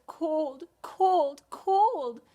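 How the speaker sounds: high, mildly shivery; shivering babies. Acted. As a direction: pretend to shiver with cold. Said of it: "said the shivering babies".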